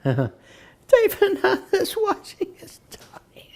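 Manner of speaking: high, slow whisper dissolving into giggles; laughing whisper. Retold or imitated. Prabhupada laughing at the devotee's answer, high breathless giggle. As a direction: squeak it out through helpless laughter. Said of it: "the devotee kept going".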